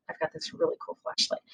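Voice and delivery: put-on hushed, breathy whisper to a child; secret whisper. Shown as a demonstration. Nurse whispering to a small child about her flashlight. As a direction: let the kid in on a secret.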